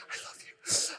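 hushed, voiceless imitation of Adrian; mock whisper. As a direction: whisper it like a film sweetheart. Imitates Adrian's tearful reply.

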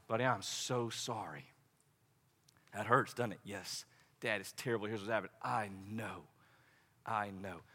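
put-on soft, hushed comfort to a hurting child; tender comfort. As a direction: soothe him in his ear. Father comforting son on lap.

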